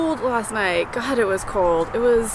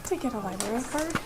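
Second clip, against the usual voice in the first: much softer, trailing repeat; softer library murmur; 10 dB quieter than usual. Real, not acted. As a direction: say it under your breath in the library.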